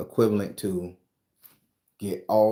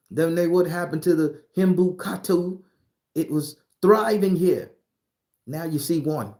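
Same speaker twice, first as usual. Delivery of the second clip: excited; 8 semitones higher, 5 dB louder than usual. Real, not acted. Excited rant about Aussies.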